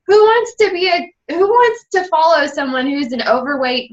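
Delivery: high-pitched retold protest; incredulous self-doubt. New mom rejects friend's coaching idea, incredulous. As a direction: act out a disbelieving objection, pitched well up.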